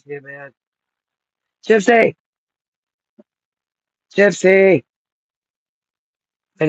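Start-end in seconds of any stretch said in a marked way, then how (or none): 1.7-4.8 calling the dog. Real, not acted. Searching for the missing dog, calling out. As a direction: call her name out.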